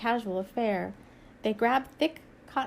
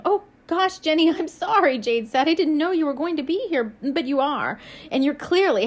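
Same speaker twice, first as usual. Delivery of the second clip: excited; 5 semitones higher, 5 dB louder than usual. Acted. Friend on balcony calls down.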